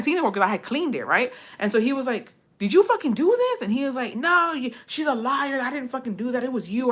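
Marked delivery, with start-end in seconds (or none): 2.6-3.6 imitating an accusing, much higher-pitched question; retold accusation. Partner confronts his brother over the mess, retold accusation. 4.1-6.9 imitating a higher-pitched protest that sinks gradually; mock indignant denial, shifting the blame; retold defensive denial. Drunk brother denies making the mess, mock defensive.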